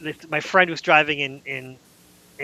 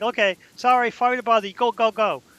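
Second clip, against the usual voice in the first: higher, hurried, sorry-sorry wave-through; apologetic border guard; 7 semitones higher than usual. Retold or imitated. Canadian guard to the waiting tour bus, higher hurried apology. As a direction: rush them along like a flustered guard, apologizing.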